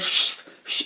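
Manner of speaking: whispered shh to keep them quiet; soft whispered shush; hushing whisper. Retold or imitated. Hushing people at premiere.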